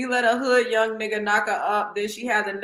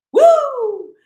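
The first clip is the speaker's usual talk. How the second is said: loud, very high woo; piercing whoop between laughs; 13 semitones higher, 9 dB louder than usual. Real, not acted. Trying to compose herself on a live, loud whoop. Letting out hilarity after laughing.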